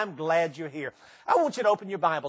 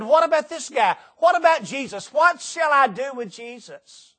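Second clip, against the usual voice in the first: higher, pressing questions, slowing at the end; Pilate pleading; 6 semitones higher, 3 dB louder than usual. Acted.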